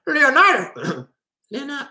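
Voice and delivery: high-pitched squeaky voice; strained, squeaky impression, badly faked; Jordan Peterson. Mimicking Peterson calling to Leonidas behind the shield wall, squeaky. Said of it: "I can't do the squeaky voice".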